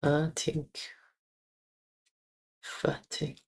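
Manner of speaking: whispered German word; breathy rehearsal under the breath; whispered practice. Shown as a demonstration. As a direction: whisper it to yourself while practising. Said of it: "practice my E".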